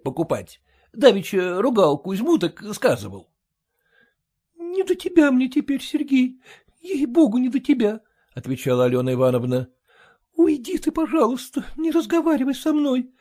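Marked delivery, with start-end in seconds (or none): 4.6-8.0 raised, tired plea to be left alone; wife brushing Sergei off, begging; weary pleading wife. A narrator's reading. Wife begging the hired man to leave her be, pleading. 10.0-13.1 raised, tired plea to be left alone; wife brushing Sergei off, begging; weary pleading wife. A narrator's reading. Wife begging the hired man to leave her be, pleading. Alena sends Sergei away.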